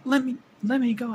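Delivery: high, loud plea to be released; high-pitched boy's urgent cry; pleading captive boy. Acted. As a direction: plead as a struggling captive child.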